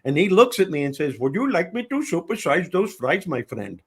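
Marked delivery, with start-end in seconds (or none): 1.2-3.8 put-on a light Indian accent on the toll booth man; lightly imitated Indian accent; Indian accent impression. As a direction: mimic the toll clerk's lilt. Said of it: "I was doing an impression".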